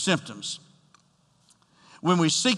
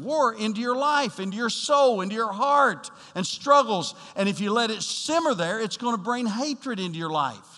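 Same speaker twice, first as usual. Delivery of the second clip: raised, louder, rising preacher's fervor; preaching at full force; 6 semitones higher, 4 dB louder than usual. Acted. Pastor warning the congregation about the flesh, preaching at full force. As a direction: drive it home to the congregation, voice lifted high.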